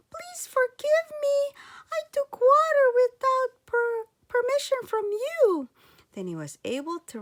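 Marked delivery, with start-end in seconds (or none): 0.1-5.7 put-on high, loud child voice begging pardon; a small boy asking forgiveness, higher and louder; boy apologizing to the fairies, high and pleading.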